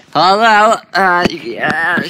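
high, loud answer; loud startled hello. Real, not acted. Player answering the haunted phone, loud hello. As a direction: blurt it into the phone.

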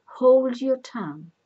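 sharp rebuke. A narrator's reading. Old man silences the messenger.

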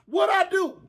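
mock holler.

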